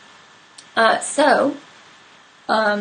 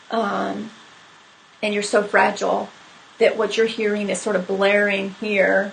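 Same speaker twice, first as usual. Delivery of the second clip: ordinary talk, nothing marked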